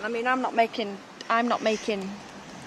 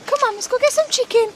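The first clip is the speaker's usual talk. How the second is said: much higher, sing-song voice coaxing a dog; talking to a dog; 11 semitones higher than usual. Real, not acted. Calling a stray dog over for chicken, high sing-song coaxing.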